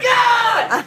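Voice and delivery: sudden high yelp of fright; startled shout. Retold or imitated. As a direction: yelp as if ambushed.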